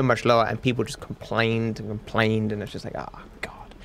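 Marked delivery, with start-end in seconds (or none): 3.0-3.7 very soft, voiceless aside; exasperated sigh. Retold or imitated. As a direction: breathe it out as a weary self-quote. Exasperated self-quote about complaining viewers.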